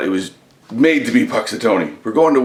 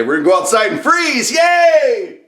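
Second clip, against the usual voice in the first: excited shout; 11 semitones higher than usual. Real, not acted. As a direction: yell it with glee. Mock cheer about freezing.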